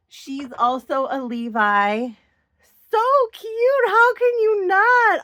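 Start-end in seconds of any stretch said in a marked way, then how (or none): 2.9-5.2 gushing. Real, not acted. Gushing over a favorite doll.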